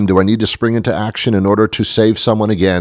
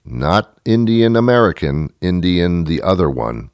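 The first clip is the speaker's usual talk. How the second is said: ordinary talk, nothing marked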